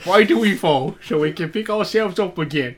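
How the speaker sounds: high, loud English butler; hammed-up film-quote English accent. Shown as a demonstration. Quoting the butler for listeners, hammed-up English accent. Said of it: "here's an English accent for you".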